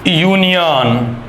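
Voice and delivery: Union drawn out very slowly and loudly, each syllable clear; slow dictation. Shown as a demonstration. Teacher slowly sounds out union for the children. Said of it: "ये मैंने कुछ word use किए हैं".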